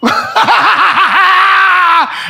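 cracks up laughing hard; burst of laughter. Real, not acted. Laughing at own joke.

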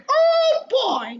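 loud, very high cartoon mouse falsetto; Mickey Mouse. Shown as a demonstration. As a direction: demonstrate a squeaky mouse exclamation, loudly. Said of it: "That's how Mickey Mouse sounds".

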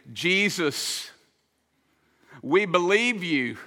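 a touch of earnest prayer; humble prayer. Retold or imitated. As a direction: pray it as a humble plea. Model prayer of submission.

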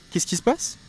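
high startled what's going on; surprised. Shown as a demonstration. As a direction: ask in amazement. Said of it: "depending on how you use your voice".

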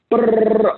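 rapid-fire rolled-lip sound; imitated chatter noise. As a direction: trill a burst to mimic someone rattling on. Imitates a client rattling on.

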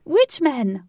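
high, loud, thrilled cry; squealing with excitement; excited squeal. A narrator's reading. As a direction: burst out with giddy excitement. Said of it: "who was popping with excitement".

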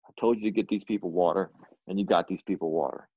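low, firm retelling of God's words; God speaking. Retold or imitated. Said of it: "He said".

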